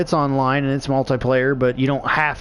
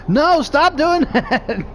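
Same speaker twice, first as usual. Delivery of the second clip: much higher, slow, breaking into laughter; laughing plea; 11 semitones higher, much slower than usual. Retold or imitated. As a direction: beg him to stop while cracking up. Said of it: "And he's like".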